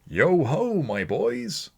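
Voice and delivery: put-on loud, high, hearty old master calling out; a big-voiced boss rallying his apprentices; jolly Fezziwig. A narrator's reading. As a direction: boom it out like a merry old employer. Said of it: "said Fezziwig".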